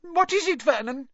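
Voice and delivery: high, alarmed appeal for an answer; frightened gasp. A narrator's reading. Terrified aunt appealing to her husband, high and alarmed. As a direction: ask it high-pitched, in fright. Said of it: "gasped Aunt Petunia".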